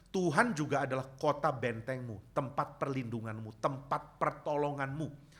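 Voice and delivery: ordinary talk, nothing marked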